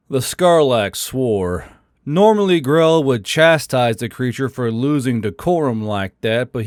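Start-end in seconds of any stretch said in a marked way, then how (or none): none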